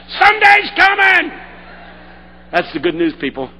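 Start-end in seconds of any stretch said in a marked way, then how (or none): 0.1-1.3 high, loud, slow shouted reply; congregation yelling back. Retold or imitated. Congregation answering the preacher with a shout. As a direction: roar it back as one. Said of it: "congregation yelled back".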